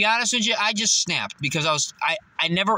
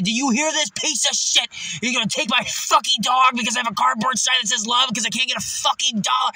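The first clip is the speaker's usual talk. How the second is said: retold screaming; 8 semitones higher than usual. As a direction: scream it from the heart to the crowd. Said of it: "I started screaming everything from the fucking heart".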